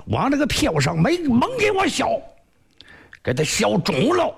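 a hint of a furious martial master giving orders; angry master's order. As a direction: bark the punishment order at the disciples. Second Hero orders a beating.

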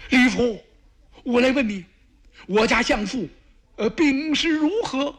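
high, strained worried question; anxious emperor. Acted. Emperor begging the messenger for news, high anxious voice. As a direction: press for news of the sick chancellor. Emperor asks about chancellor's illness.

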